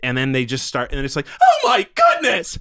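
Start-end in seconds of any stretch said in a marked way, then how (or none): 1.4-2.6 very high, loud falsetto gasping; mock shocked shriek. Imitating onlookers suddenly objecting to racism, falsetto mock shock. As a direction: squeal it slowly in performative horror. Mocks bystanders' performative shock.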